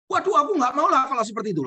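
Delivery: high, fast, whiny refusal; wife's voice. Acted. Wife refusing to embrace her mother-in-law, high whiny protest. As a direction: whine it high as the reluctant wife.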